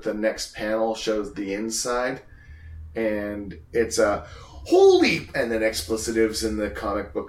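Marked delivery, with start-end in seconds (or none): 4.3-5.3 long drawn-out holy; stunned exclamation. Acted. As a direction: draw it out slowly in shock. Acting out the panel's exclamation.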